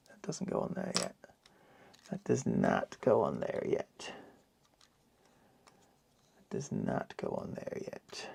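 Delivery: mock Mexican accent, hushed; lightly voiced cod Mexican accent, soft. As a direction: fake Mexican accent, muttered low. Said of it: "uh, cod accent".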